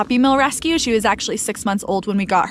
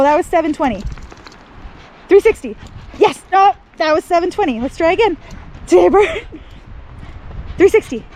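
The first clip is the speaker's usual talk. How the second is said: excited praise; 8 semitones higher than usual. Real, not acted. Owner praising her dog through the trick run, excited. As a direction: cheer each trick on.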